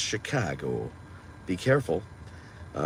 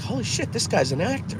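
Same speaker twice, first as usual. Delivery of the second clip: stunned surprise; 13 semitones higher than usual. Retold or imitated. Watching Band of Brothers, shocked at the actor. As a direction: pitch jumps way up in amazement. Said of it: "I was like".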